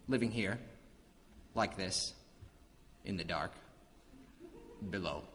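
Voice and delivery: sinking low and soft, phrase by phrase; gloomy brooding. Acted. God admitting life in the dark is morbid, gloomy brooding. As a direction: let it fade into morbid gloom.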